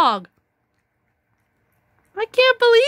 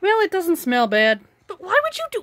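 ordinary talk, nothing marked

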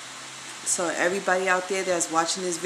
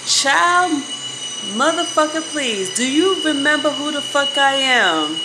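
angry rant; 2 semitones higher, 4 dB louder than usual. Real, not acted. Ranting at the ex who put hands on him, furious and loud.

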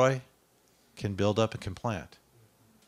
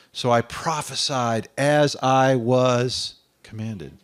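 Isaiah crying woe is me, loud; preaching at full force; 5 dB louder than usual. Acted.

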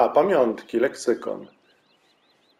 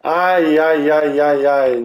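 1 dismayed ow ow; 3 semitones higher, 6 dB louder, much slower than usual. Real, not acted. Failed throw in mini-game.

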